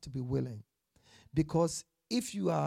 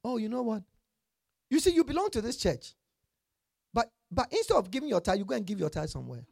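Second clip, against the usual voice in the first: higher, louder, quickening self-justification; mock reasoning churchgoer; 4 semitones higher, 6 dB louder, faster than usual. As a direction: voice a churchgoer talking himself out of tithing. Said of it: "that's how we reason".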